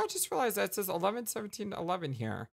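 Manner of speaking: ordinary talk, nothing marked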